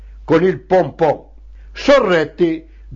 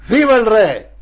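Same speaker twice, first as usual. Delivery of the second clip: raised-pitch response cry; rallying cheer; 5 semitones higher than usual. Acted. Children answering the secretary's salute, rallying cheer.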